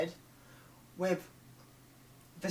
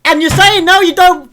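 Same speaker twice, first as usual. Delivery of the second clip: higher, loud indignant retort; angry retort; 7 semitones higher, 11 dB louder than usual. Real, not acted. Fan yelling back at the writers, angry retort. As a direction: shout back at the show. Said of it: "I'm, I'm so angry".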